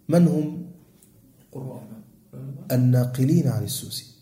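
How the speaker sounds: ordinary talk, nothing marked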